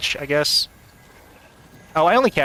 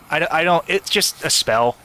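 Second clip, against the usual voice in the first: usual speaking voice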